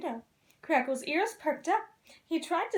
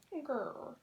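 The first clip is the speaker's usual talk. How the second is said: hopeful mumble; 10 dB quieter than usual. Acted. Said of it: "he mumbled hopefully".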